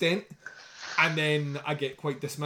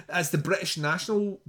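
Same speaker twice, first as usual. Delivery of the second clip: usual speaking voice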